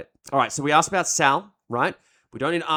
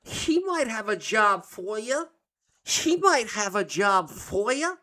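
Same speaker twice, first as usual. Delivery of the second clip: put-on slow, high, rising New York accent; mimicking Louis's accent; 5 semitones higher, slower than usual. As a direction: imitate the diner owner's drawn-out New York accent. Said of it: "I love the accent too".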